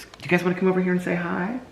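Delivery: high sing-song puppy talk. Real, not acted. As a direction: coo at the puppies. Talking to puppies.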